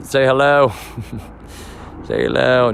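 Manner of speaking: calling out to a helicopter overhead, playful. Real, not acted. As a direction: say hello to the helicopter above. Greeting a passing helicopter overhead.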